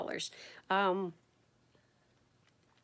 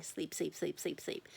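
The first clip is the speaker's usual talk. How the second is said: each sleep softer until it is only whispered; fading to whisper; 3 semitones lower, 9 dB quieter than usual. Pretend. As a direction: let the words drift off into a whisper like dozing off. Acting out endless sleeping days.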